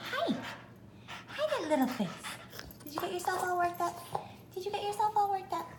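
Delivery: high, soft pet-talk voice. Real, not acted. Cooing at her dogs.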